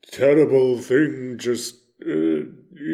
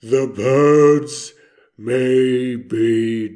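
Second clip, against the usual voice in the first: loud drawn-out name, then sinking lower; grave, slow announcement; 3 semitones lower, 6 dB louder, slower than usual. Retold or imitated. Breaking the news to Orville about the birds, slow and grave. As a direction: break it slowly, with long pauses.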